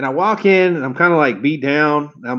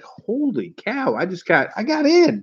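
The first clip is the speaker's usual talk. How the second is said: breathless, high-pitched astonishment, slow at first; can't believe your luck, voice jumping up; stunned disbelief; 8 semitones higher, slower than usual. Retold or imitated. Stunned at getting the job.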